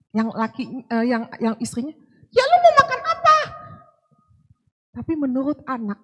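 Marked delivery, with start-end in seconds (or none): 2.3-3.4 imitating a wife hollering back, very high; fast, loud, high-pitched mock yell; sounds angry. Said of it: "itu marah-marah". (menirukan istri menyahut teriak, cepat melengking)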